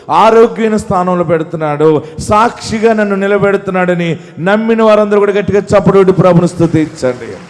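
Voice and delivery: loud, emphatic sermon call; rally the crowd to clap and praise; forceful preaching. Acted. Pastor urging the congregation to clap and praise God, forceful preaching.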